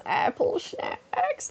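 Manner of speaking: very high, breaking, drawn-out squeak; exaggerated silly voice. Shown as a demonstration. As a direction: stretch it slowly into a silly falsetto. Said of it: "That's exactly how I would say it".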